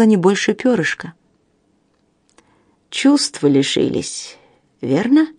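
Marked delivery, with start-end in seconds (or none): 2.9-5.3 eldritch horror. Acted. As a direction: growl it like a monster in the dark. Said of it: "прорычал искажённый голос". (низкий искажённый рык)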